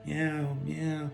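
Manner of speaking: soft high mewing; faint high kitten meow, barely voiced; imitated cat meow. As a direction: mew faintly like a hurt cat. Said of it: "What's that noise?".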